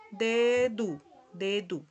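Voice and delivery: dedo as "DE-du"; final o as u. Shown as a demonstration. Teacher repeats past example, dedo said "DE-du". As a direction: model dedo ending on a u sound. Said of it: "se pronuncia como si fuera una U".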